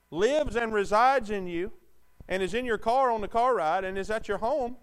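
high, loud, slowed-down pulpit fire; preaching at full force. Acted. Pastor pressing the congregation about their music, preaching hard and loud. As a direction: build it into a ringing challenge.

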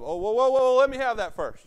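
high, loud, alarmed mock panic; a caught husband snatching his phone back in a fright; panicked grab. Preacher acting a spouse grabbing the phone, panicked and loud.

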